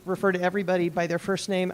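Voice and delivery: usual speaking voice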